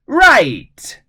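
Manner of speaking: high, loud cheer; loud shout. Acted. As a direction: shout it together. Everyone agrees loudly.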